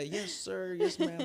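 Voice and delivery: polite Southern manners, climbing high; playful high mock-Southern courtesy; Southern accent. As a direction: imitate a courteous Southerner, pitch lifting sharply. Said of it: "I love the Southern accents".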